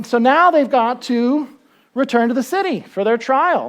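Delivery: ordinary talk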